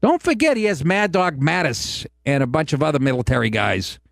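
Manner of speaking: usual speaking voice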